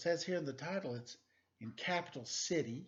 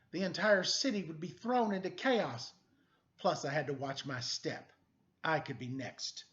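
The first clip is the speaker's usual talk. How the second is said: lively, louder read-aloud narration; animated picture book reading; story narration; 2 semitones higher, 5 dB louder than usual. A narrator's reading. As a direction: read it like a noir storybook to kids.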